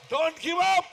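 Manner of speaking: high, loud exhortation; fired-up preacher's cry; rallying shout. Real, not acted. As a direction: shout it out to rouse the congregation. Preacher calls out to congregation.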